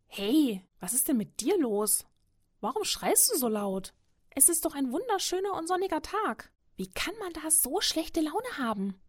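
high-pitched, lively small animal voice; high, cheery hare voice; chirpy little hare. A narrator's reading. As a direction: pipe up as a friendly, puzzled hare. Chirpy hare addresses a grumpy wolf.

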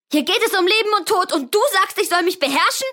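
high, loud, rushed outrage; a desperate girl shouting at her father; tearful furious shout. Acted. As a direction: scream it through tears. Girl yells at father, crying.

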